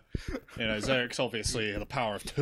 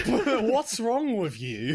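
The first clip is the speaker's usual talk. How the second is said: slow, laughing, higher mock outrage; 9 semitones higher, much slower than usual. Friend voicing Zarek scolding, slow laughing outrage. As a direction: stretch it out through laughter. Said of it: "You think they need help?".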